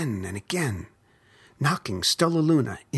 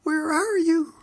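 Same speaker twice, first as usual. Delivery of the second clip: put-on tiny, much higher squeaky baby bat voice; small lost bat squeak calling for mother; 3 dB louder than usual. A narrator's reading. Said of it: "Stella Luna squeaked".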